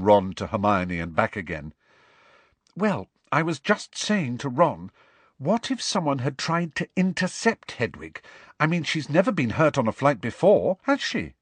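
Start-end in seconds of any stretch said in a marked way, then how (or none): 2.8-11.3 higher-pitched, concerned character voice; worried schoolgirl figure. Acted. Hermione telling Harry her fear about Hedwig, worried. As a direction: voice a girl reasoning through a worry, pitched up.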